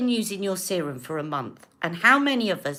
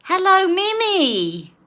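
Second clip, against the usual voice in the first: sing-song greeting; 10 semitones higher, 7 dB louder than usual. Real, not acted. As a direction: call out brightly to a viewer. Greeting a viewer in live chat.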